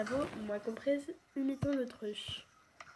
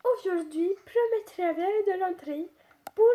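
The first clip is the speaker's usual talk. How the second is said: higher, louder, badly faked English accent; attempted English accent; 5 semitones higher, 6 dB louder than usual. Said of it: "j'arrive pas à faire l'accent anglais". (accent anglais raté, voix haute)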